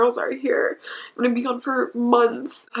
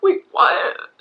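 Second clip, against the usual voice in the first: stunned disbelief; 11 semitones higher, 4 dB louder than usual. Retold or imitated. Shocked by the judge's ruling.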